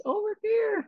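high-pitched thrown-voice call; playful, high sing-out imitation; mimicking a human voice. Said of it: "mimicking the human voice".

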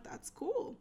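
high, soft easygoing quote; breezy acceptance. Retold or imitated. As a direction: lightly let it go in a high, small voice. Quotes own easygoing reply to date.

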